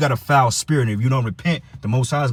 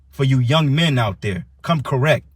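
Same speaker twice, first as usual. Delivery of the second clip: normal talking voice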